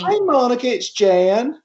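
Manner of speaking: high, bright put-on voice of Jan; Jan Tana impression. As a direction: pitch it way up like Jan on the phone. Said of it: "you can do her, uh, accent way better than me".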